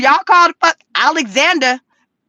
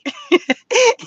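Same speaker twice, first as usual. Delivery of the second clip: quick high burst of laughter; 9 semitones higher than usual. Real, not acted. To viewers about breaks, a high laugh. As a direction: laugh out at your own joke.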